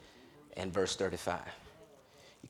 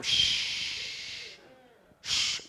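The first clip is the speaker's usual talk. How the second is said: shushing; 6 dB quieter than usual. Acted. The crowd shushing Bartimaeus.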